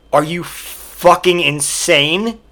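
ordinary delivery

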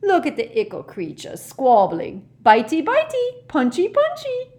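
cackling, high-pitched taunts; Peeves. Acted. Poltergeist jeering at two squabbling elves, gleeful taunting. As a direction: goad them in a loud, high singsong. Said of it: "letting out a loud cackle".

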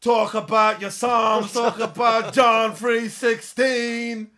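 slow preaching shout. Retold or imitated. Quoting the famous promo line.

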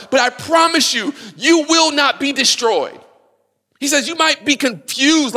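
a fiery preacher quoting Paul over the church; preaching at full force. Acted. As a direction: build the congregation up with fervor. Preaching Paul's words with rising force.

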